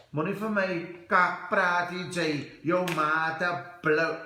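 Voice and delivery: loud-and-proud Cape Flats accent. Shown as a demonstration. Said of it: "Cape Flats accent. I can do that easy".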